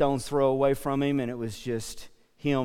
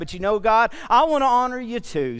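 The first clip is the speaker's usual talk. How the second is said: impassioned prayer; 6 semitones higher, 6 dB louder than usual. Acted. Heartfelt prayer voiced loudly.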